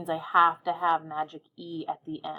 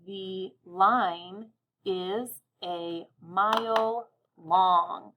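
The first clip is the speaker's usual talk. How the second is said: very slow, each word separated; word by word counting; much slower than usual. Shown as a demonstration. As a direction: stretch it out to count the words. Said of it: "Let's count how many words we hear".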